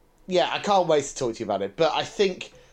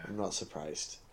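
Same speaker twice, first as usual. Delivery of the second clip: much softer, muttered under breath; 7 semitones lower, 15 dB quieter than usual. Real, not acted. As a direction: mutter the repeat to yourself. Muttered repeat of own reply.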